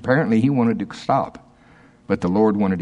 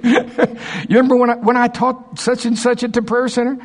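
laughing mock reminder; 13 semitones higher than usual. Imitating Dave, laughing.

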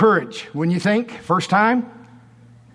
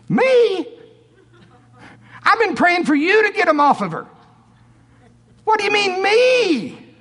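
very high, loud, indignant disbelief; Norval's shocked squeal; 4 dB louder than usual. Retold or imitated. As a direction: squeak it out, falsetto outrage. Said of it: "Am I doing it pretty good?".

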